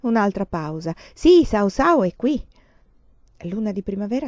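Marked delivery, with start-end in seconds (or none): 1.1-2.4 shouting into the phone. A narrator's reading. Shouting on telephone call.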